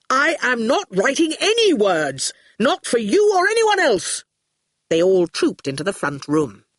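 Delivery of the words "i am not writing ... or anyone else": high-pitched, furious refusal, acted; shouting. A narrator's reading. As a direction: refuse with rising outrage. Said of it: "Quentin shouted".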